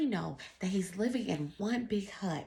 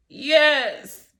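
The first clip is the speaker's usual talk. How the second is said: eager poor man; 8 semitones higher, 12 dB louder than usual. Acted. Man answers rabbi eagerly.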